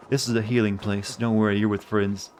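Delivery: soothing, hushed cult leader. Shown as a demonstration. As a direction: lure them in gently, like a cult leader. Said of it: "my cult leader voice right now".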